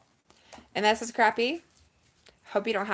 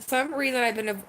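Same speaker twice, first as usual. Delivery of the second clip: ordinary talk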